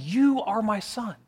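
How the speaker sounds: "You" drawn out and lifted high; stress on YOU. Acted. Voice from heaven speaking directly to Jesus, stress on "You". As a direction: lean slowly on "You", addressing him directly. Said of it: "talking directly to Jesus".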